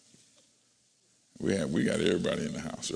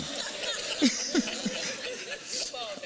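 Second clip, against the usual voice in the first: high laugh; 5 dB quieter than usual. Real, not acted. Pastor laughs at own joke.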